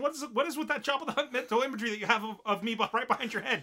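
high, loud and fast offended squeal; outraged girl voice. Retold or imitated. Olivia scolding her friend over the image, high fast outrage. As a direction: rattle it off like an insulted anime girl. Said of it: "she refers to herself as".

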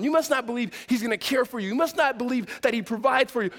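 normal talking voice

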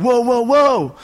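high, loud alarmed whoa; startled whoa. Retold or imitated. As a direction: react to a falling lamp. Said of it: "And I said".

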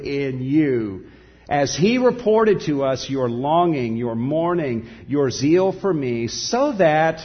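loud, rising preaching of Paul's words; impassioned scripture reading. Acted. Preacher reading Paul's letter to the Corinthians, impassioned. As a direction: proclaim it with full force.

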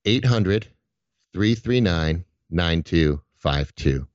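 over enunciating each digit; slow and clear. Shown as a demonstration. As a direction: spell out the number slowly. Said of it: "one more time slowly for our listeners".

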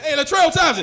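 loud, high acted call. Comic hailing friend by real name, calling out.

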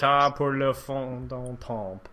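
put-on slow, deliberately mangled French accent; bad French accent. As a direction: butcher the French title on purpose. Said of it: "Your French is impeccable".

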